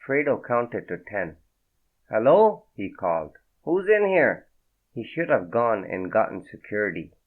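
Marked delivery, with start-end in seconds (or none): 2.1-2.7 loud, high call into the room. Acted. Fredo calls into the silent room, loud and wary. 3.6-4.5 loud, high call into the room. Acted. Fredo calls into the silent room, loud and wary.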